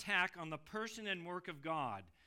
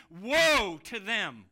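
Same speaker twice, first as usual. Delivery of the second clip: slow, much louder cry of woe; emphatic cry; 2 semitones higher, 12 dB louder, much slower than usual. Shown as a demonstration. Preacher crying out a warning to the congregation, loud and slow. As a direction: draw out Woe like a lament. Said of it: "this cry".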